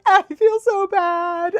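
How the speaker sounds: high, slow, drawn-out whine; mock guilty wail. Rider feeling bad for the horse, mock guilty whine. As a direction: wail with comic remorse.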